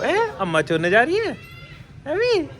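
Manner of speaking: high, rising teasing question. Real, not acted. बच्ची से लाड़ में सवाल. (बच्ची से लाड़ भरा ऊँचा सवाल)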